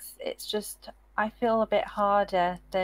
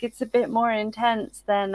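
ordinary talk

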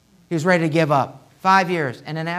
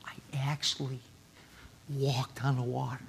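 hushed, breathy whisper of wonder; awed whisper; 5 semitones lower, 14 dB quieter than usual. Retold or imitated. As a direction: whisper it like a secret miracle. Peter imagined telling a congregation.

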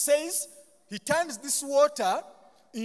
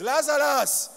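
calling out; 4 semitones higher, 9 dB louder, slower than usual. Acted. Jesus calls the dead man.

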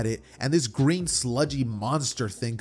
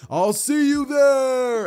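high, loud, long-drawn call; shouted farewell; 13 semitones higher, 7 dB louder, much slower than usual. Acted. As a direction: holler it while flying away. All Might shouts flying off.

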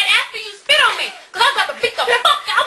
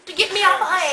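ordinary talk

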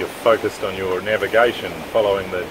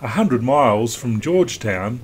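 even, measured documentary read; calm voiceover read to the viewers; vlog voiceover; 3 semitones higher than usual. Acted. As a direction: narrate the passage like a sailing film.

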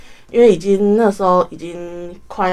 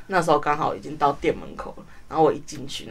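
ordinary talk

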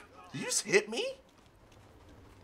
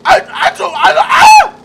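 panicked shouting; 10 semitones higher, 11 dB louder than usual. Real, not acted. Stuck, panicking.

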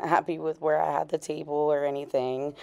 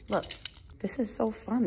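normal talking voice

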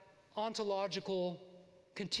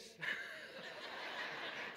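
soft breathy laugh; 5 dB quieter than usual. Real, not acted. Laughs at his own anecdote.

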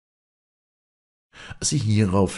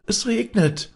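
put-on very high, loud children's call; 5 dB louder than usual. A narrator's reading. Children running in the street, calling out high. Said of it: "riefen die Kinder".

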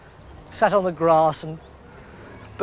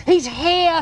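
higher, louder call; excited shout; 8 semitones higher, 4 dB louder than usual. A narrator's reading. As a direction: shout upstairs to the inspector. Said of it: "Fat Tulip shouted".

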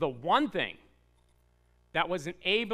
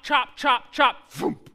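shouted chop sounds, higher and louder; chopping sound effect; 4 semitones higher, 5 dB louder, much slower than usual. Acted. Preacher acts out the axe strikes, shouting. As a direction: bang them out like axe blows.

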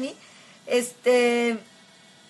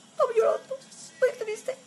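mock crying; 12 semitones higher than usual. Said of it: "No, no es cierto".

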